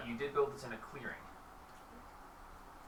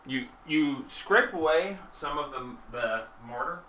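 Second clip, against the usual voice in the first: ordinary delivery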